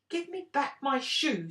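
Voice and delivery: high, loud cross demand; a girl crossly demanding her shoe back from the witch. A narrator's reading. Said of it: "said Dorothy crossly".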